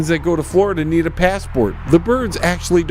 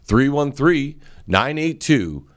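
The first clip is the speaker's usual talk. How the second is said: softer, earnest sponsor read; 3 semitones lower, 3 dB quieter than usual. Acted. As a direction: sell the painter like a radio ad. Host reads sponsor ad.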